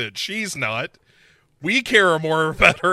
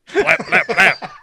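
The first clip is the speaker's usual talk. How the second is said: high, loud mock gunshots; gunshot noises; 5 semitones higher, 8 dB louder, slower than usual. Brimley firing in Vegas, mock gunshots. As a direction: spit out the bang sounds.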